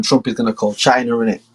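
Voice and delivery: normal talking voice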